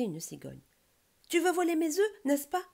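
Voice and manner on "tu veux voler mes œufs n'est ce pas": high, loud challenge; indignant accusation. A narrator's reading. Stork confronting a suspected egg thief, indignant accusation. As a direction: accuse the intruder loudly and high.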